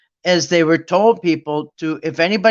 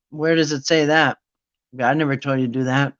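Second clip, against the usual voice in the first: normal talking voice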